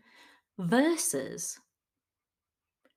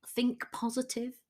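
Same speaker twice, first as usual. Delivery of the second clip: "think positive" said softly, trailing down, half to oneself; mild advice; 5 semitones higher, 5 dB quieter than usual. Retold or imitated. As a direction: offer "think positive" quietly as a weary tip. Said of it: "That's one that I hear a lot".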